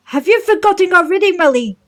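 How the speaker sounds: put-on high, loud, outraged old Zora; indignant old advisor voice. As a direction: protest shrilly to the king. Streamer voices Muzu.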